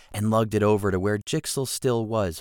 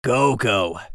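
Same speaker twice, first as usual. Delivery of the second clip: flat voice; 3 semitones higher, 8 dB louder than usual. Acted. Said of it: "repeated in a flat voice".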